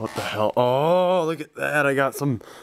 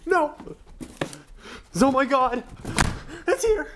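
excited squeal; 11 semitones higher than usual. Real, not acted. Fan finding his preorder arrived, shrieking with joy.

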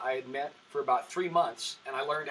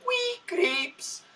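high, sing-song mock Scottish accent on the punchline; Scottish accent tagged on, played for a laugh; fake Scottish accent. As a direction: deliver it as a hammy fake Scotsman. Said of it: "And I tell stupid one-liners like".

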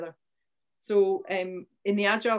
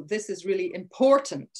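IMPORTANT punched out loud; punched-out emphasis; 5 dB louder than usual. Shown as a demonstration. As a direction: really punch the word "important". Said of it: "punch those words out".

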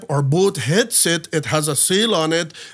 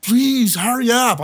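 retold urgent plea; 7 semitones higher than usual. Drowning man to his rescuers, retold pleading. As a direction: act out begging the rescuers to hurry.

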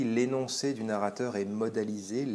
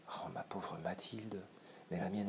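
whispered tender pity; hushed whisper; 11 dB quieter than usual. Acted. Forestier revealing the necklace was fake, whispered. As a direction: whisper it as the blow lands. Final revelation scene.

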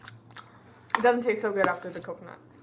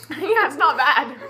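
laughing; 5 semitones higher, 5 dB louder, slower than usual. Real, not acted. Laughing after tasting bean.

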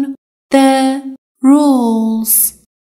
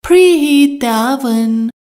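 slow model phrase; slower than usual. Shown as a demonstration. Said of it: "teacher models an English sentence".

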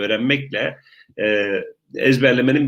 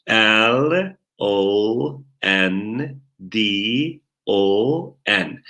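slow English letters, widely spaced; spelling out; much slower than usual. Shown as a demonstration. Teacher models spelling a hometown, slow letter-by-letter spelling. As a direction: give each letter its own long beat. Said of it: "orayı heceleyecek".

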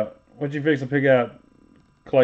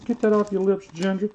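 pitched higher, teasing the line back; higher-pitched mock repeat of the insult; 6 semitones higher, faster than usual. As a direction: throw the insult again, higher and mocking. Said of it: "He said".

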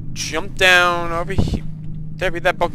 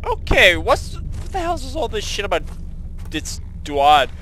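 ordinary talk

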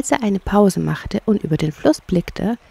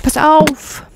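high, louder warning cry; sudden warning call; 10 semitones higher, 6 dB louder than usual. Acted. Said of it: "rief der Maulwurf plötzlich". (plötzlicher Warnruf)

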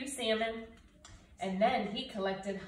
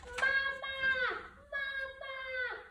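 very high, tiny chirping voice; baby gosling cry; much slower than usual. Acted. Hatchlings calling the bear their mother, high and tiny. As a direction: squeak it out like a newborn chick. Newborn goslings call Bruce.

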